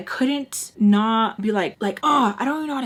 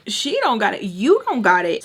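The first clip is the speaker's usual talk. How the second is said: sassy, louder retort; indignant echo; 5 dB louder than usual. Retold or imitated. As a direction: throw his words back indignantly. Throws his words back.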